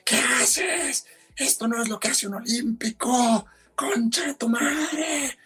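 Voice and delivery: high-pitched mock yell, pushing him to get up; angry yelling. Chilean rival yelling at the fallen skier, high mock shout. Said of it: "me empieza a gritar".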